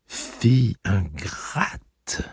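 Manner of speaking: slow, hushed whisper laced with venom; sweetly poisonous near-whisper; venomous soft voice. A narrator's reading. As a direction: caress with the voice while meaning poison. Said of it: "lui dit sa tante d'une voix douce, si venimeuse qu'elle en devenait caressante".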